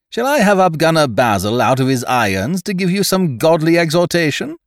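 high, loud sneering question; mocking. A narrator's reading. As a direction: scoff at their piety. Ned mocks the men.

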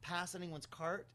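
ordinary talk

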